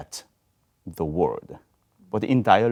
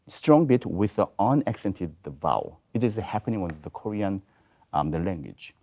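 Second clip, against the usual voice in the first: usual speaking voice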